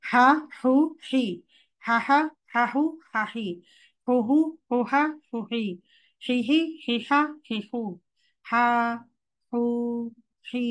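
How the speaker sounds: reciting হা হু হি from the throat; letter drill. Shown as a demonstration. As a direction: run the vowel drill cleanly. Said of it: "একটু চেষ্টা করে দেখি".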